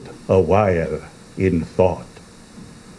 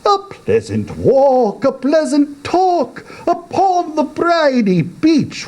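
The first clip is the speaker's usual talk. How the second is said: much higher, coaxing and sweet; beseeching walrus. Acted. Walrus coaxing the oysters, high and beseeching. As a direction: lure the oysters out.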